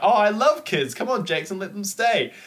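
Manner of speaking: high, put-on girlish plea; gushing date. Date begs Jackson to keep the kids, high gushing voice. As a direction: gush it in a mock high voice. Acting the girl pleading with Jackson.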